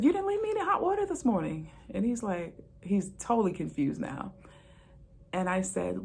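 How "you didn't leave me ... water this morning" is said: much higher, fast, sing-song repeat; mock sassy repeat. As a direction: hammer the joke home, high and quick.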